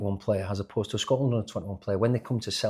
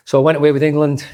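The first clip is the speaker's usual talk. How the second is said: emphatic, louder; 5 semitones higher, 12 dB louder, faster than usual. Real, not acted. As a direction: say it loud and higher, with a rueful punch. Emphatic retelling of the outcome.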